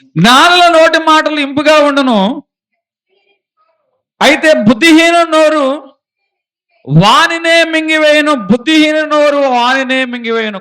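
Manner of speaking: loud, high, ringing Bible reading; scripture proclaimed. Acted. Preacher reading a proverb to the congregation, booming. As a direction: proclaim the verse to the whole church. Reads scripture at full force.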